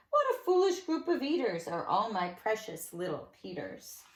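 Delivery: plain reading voice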